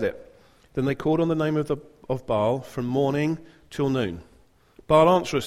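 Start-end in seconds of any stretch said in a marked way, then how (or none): 4.9-5.5 shouting. Acted. Prophets crying out to Baal, loud shout. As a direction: call out to the silent god. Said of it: "they shouted".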